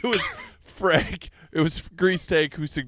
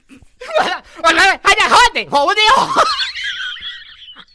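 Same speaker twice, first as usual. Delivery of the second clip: high, loud mock bird cries; loud pelican squawking; 8 dB louder than usual. Playing a pelican after scooping up fish, squawking. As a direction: screech like a big hungry bird.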